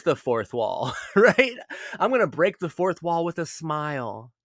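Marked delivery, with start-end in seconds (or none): 1.0-1.6 laughing. Real, not acted. Laughs at own idea. 2.0-4.3 light, sweet, cheeky voicing of Effie; a hint of a demure girl breaking the fourth wall; Effie with a demure smile. Vlogger voicing Effie breaking the fourth wall, demure and cheeky. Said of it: "says Effie, with a demure little smile".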